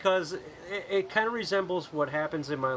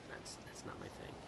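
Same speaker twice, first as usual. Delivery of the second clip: whispered; 22 dB quieter than usual. Real, not acted. Embarrassed backtrack, trailing.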